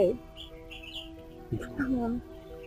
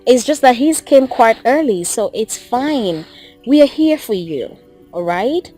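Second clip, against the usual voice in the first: low-pitched, steady reassurance; comforting and measured; consoling; 9 semitones lower than usual. Acted. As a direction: talk the griever down in a lowered voice. Consoles grieving partner about death.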